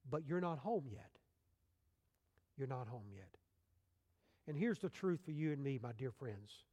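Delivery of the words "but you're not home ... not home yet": softly voiced words of comfort, falling away; gentle reassurance. God gently reassures the missionary.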